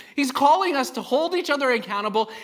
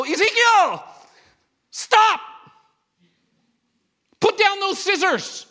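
high, loud stern dad warning; 9 semitones higher, 5 dB louder, slower than usual. Retold or imitated. Father calls out to little Ezekiel, stern loud dad voice. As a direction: bark the warning at a kid with scissors. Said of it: "I'm using my dad voice".

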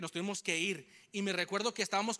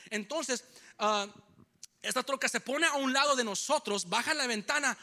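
normal talking voice

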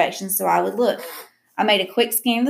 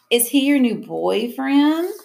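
teasing; 6 semitones higher, slower than usual. A narrator's reading. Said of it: "August teased".

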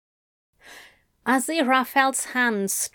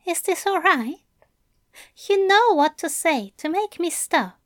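higher, gently concerned lover; Aziraphale; 7 semitones higher, faster than usual. Acted. Angel asks bound Crowley's consent, gently concerned. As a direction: check in with care, voice lifted.

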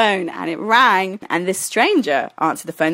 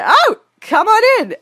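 high, loud, welcoming sing-out; breezy invitation; 6 dB louder than usual. Retold or imitated. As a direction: imitate a carefree hostess calling you in. Retold girl's breezy invitation.

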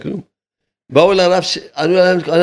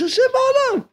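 high proclaiming voice. Acted. Rabbi declaring God is in the world, high. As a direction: sing it out high.

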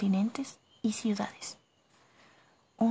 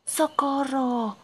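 drawn-out, raised call for help; cry for help; 5 semitones higher, 5 dB louder, much slower than usual. A narrator's reading. Lost narrator calls out for anyone to hear, drawn-out shout. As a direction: shout it out long and high, calling into the tunnels. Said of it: "grité".

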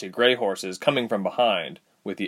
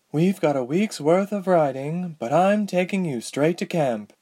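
higher voiced woman, urgent; young woman voice; 9 semitones higher than usual. A narrator's reading. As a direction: voice Ladala a bit higher. Ladala voiced by narrator.

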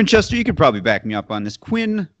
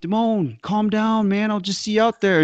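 high, breezy mock calm; teasing high voice; 8 semitones higher than usual. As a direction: wave off his worry, lilting high. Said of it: "You're like".

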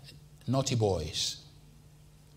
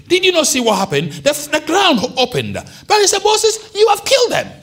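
much higher, much louder preaching cry; full-force preaching; 8 dB louder than usual. Acted. Preacher telling the congregation of the rebellion, at full force. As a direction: preach it out to the back row.

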